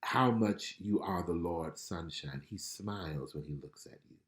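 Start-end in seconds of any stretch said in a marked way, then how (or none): 0.0-2.4 a touch of a reassuring God; tender divine voice. Retold or imitated. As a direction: speak it softly as a loving reminder. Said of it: "I hear the Lord saying".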